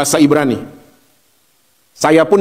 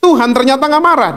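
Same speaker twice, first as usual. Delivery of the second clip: ordinary talk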